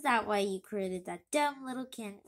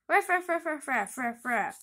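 dog barking; 3 dB louder than usual. Acted. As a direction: bark like a playful dog.

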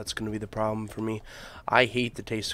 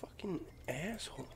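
whispered curse; 5 semitones higher, 12 dB quieter than usual. Real, not acted. Cursing under breath in game.

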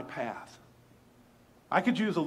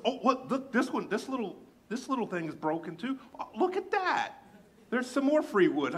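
high, playful feigned discovery; lightly voiced sly old woman, playing innocent; mock surprise; 8 semitones higher than usual. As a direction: pretend you just noticed it. Woman pretends wood is broken.

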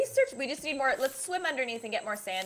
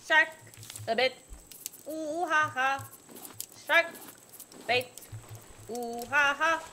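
taunting sing-song. Pretend. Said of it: "Please stop, ma'am".

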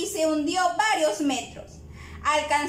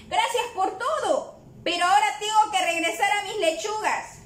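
higher-pitched cow character, lively complaint; protesting storybook cow; 4 semitones higher than usual. Acted. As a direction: voice the cow up high, turning down a friend's help. Voices the cow replying to Gaspar. (voz aguda de vaca que protesta)

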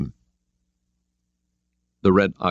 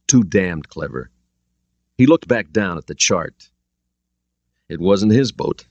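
plain reading voice

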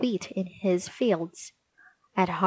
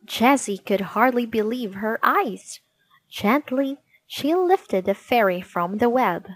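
plain reading voice

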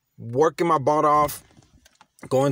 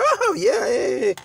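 much higher, gushing oh yeah; mock delight; 13 semitones higher, 3 dB louder than usual. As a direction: ham up the thrill of new riches. Mimics his own past delight.

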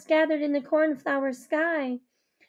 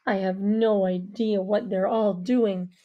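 low male voice; 7 semitones lower than usual. Acted. Avery speaks.

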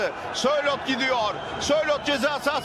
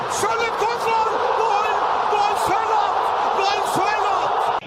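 high, loud goal scream; screaming goal call; 8 semitones higher, 4 dB louder than usual. Real, not acted. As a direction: scream the goal at full force. (bağırarak gol çığlığı)

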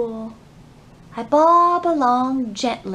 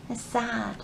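sad; 11 dB quieter than usual. Acted. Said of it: "This is when my heart".

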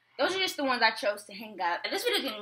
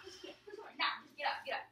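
usual speaking voice

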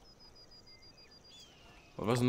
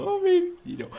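delighted squeal. Real, not acted. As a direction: squeak it out at the cute dino. Squealing at baby dinosaur.

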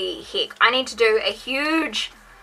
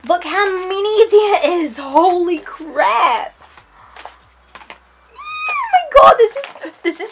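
excited; 8 semitones higher, 4 dB louder than usual. Real, not acted. Overwhelmed by glitter haul.

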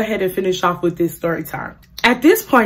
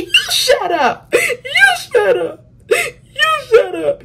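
very high, slow drawn-out wail; hammed-up crying squeal; retold tearful screaming; much slower than usual. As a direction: screech it back, stretching each word. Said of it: "'Cause I started screaming".

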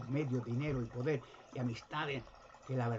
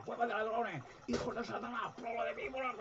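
high, raised mock rebuke at the thieves; retold furious shout; 8 semitones higher than usual. Jesus shouting at the temple merchants, high and raging. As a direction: cry it out like an outraged prophet. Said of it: "le gritaba".

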